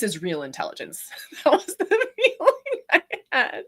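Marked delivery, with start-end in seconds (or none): 1.2-3.6 laughing through the words. Real, not acted. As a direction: giggle while explaining the feeling. Laughs while recounting.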